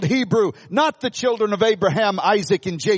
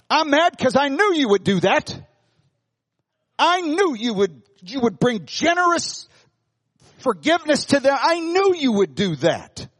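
Jonah's mild mock anger; 7 semitones higher than usual. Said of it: "that's how he's talking to God".

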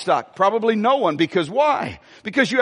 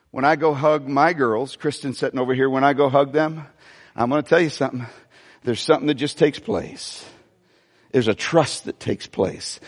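tender, low reflection; 6 semitones lower than usual. Real, not acted. Tender talk about hugging daughters.

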